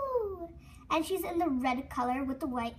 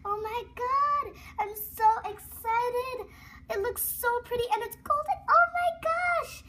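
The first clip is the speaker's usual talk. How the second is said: much higher, loud thrilled cries; excited; 8 semitones higher, 4 dB louder than usual. Real, not acted. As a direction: gush over the golden toy. Still thrilled by the prize.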